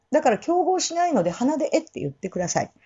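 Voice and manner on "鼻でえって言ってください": ordinary talk, nothing marked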